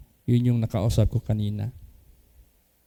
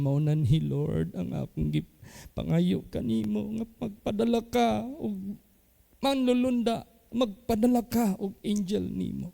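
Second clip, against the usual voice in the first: a mild higher, pleading quoted prayer; tearful prayer; 10 semitones higher, 4 dB quieter than usual. Retold or imitated. Pastor quoting the weeping man's prayer, mildly higher and pleading. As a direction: lightly voice the weeping man begging the Lord. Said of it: "day sige siyag hilak".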